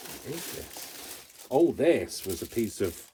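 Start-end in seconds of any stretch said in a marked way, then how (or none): 0.1-1.3 1 curious murmur. Real, not acted. Puzzling over a craft scrap.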